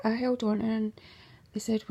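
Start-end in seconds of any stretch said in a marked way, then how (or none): none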